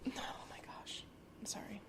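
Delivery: unvoiced whisper, real discomfort; breathy aside through reflux. Real, not acted. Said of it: "Excuse my acid reflux".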